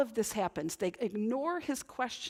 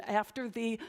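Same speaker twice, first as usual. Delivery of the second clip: ordinary talk, nothing marked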